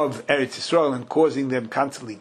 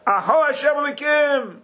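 mock scream; 12 semitones higher than usual. Teacher acting out the prophet's cry to God, high mock scream. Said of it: "and I started screaming".

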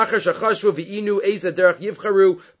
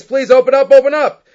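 loud, high desperate plea; scream; 7 semitones higher, 9 dB louder than usual. Retold or imitated. Stranded traveler begs at the inn door, screaming. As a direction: cry out to be let in. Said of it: "he screams".

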